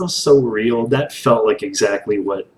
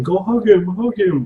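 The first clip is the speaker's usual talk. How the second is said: high, eager plea; pitched-up urging, hug him twice; cheering on a character to hug him; excited urging; 10 semitones higher than usual. Retold or imitated.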